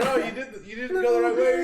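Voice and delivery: high, loud mock scolding; exasperated call-out. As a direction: shout it over at the lost driver. Telling off the lost trucker.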